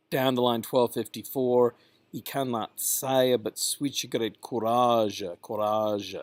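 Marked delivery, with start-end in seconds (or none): 2.1-6.2 "courage" as "koo-rah-zhuh"; Middle English pronunciation. Shown as a demonstration. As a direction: sound the final e of "courage". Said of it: "talk about the pronunciations".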